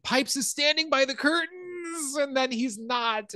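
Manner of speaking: high, drawn-out singsong squeal of delighted fright; thrilled squeal. Real, not acted. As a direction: squeal the scary reveal, stretching the words out high. Thrilled retelling of scary reveal.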